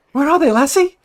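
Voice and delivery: high, loud, hammed-up question; mock dog-owner voice. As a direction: call it out high, as if quizzing a clever dog.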